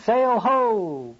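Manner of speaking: high, drawn-out lookout's cry; triumphant call. A narrator's reading. Lookout calls from the main masthead, triumphant call. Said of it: "a triumphant voice from the main masthead".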